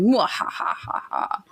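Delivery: slow, breathy villain laugh; maniacal laugh. Pretend. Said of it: "Maniacal laugh".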